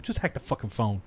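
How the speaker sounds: offhand quiet reply. Retold or imitated. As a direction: toss it off under the breath. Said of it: "He's like".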